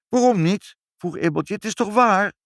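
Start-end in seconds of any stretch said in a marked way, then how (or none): 0.1-0.8 put-on high, loud little girl; defiant child. A narrator's reading. Child answering back to her mother, defiant. 1.5-2.3 put-on high, loud little girl; insisting child. A narrator's reading. Child arguing with her mother, insisting.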